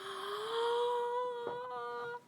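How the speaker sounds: high breathy shocked gasp; a soft gasp of shock; sharp gasp. Real, not acted. Reader stunned by the panel, sharp gasp. Reacting to the reveal.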